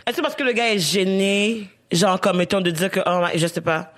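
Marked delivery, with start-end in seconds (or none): none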